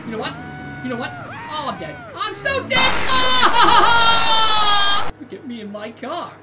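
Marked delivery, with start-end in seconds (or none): 2.1-2.9 high, loud cry of doom; panicked shout. Real, not acted. About to be caught by Homer. 3.1-5.1 very loud, sustained wail; long panicked scream. Real, not acted. Caught by Homer.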